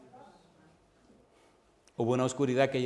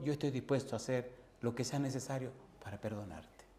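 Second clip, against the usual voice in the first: much softer after the cry; hushed promise; 8 dB quieter than usual. Acted. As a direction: drop to an earnest murmur. God's plea after outburst. (promesa en voz baja)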